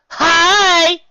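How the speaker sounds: high, loud cheeky yell; taunting yell. Acted. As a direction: wave down at the monster. Pegasus taunts the bear.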